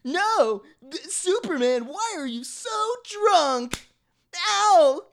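very high, slow whine; squeaky cartoon victim. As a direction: whine and yelp in pain.

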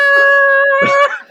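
loud, very high imitated jingle; sound made by mouth. Shown as a demonstration. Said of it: "Make the sound with your mouth".

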